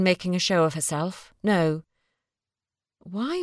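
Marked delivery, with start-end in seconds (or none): none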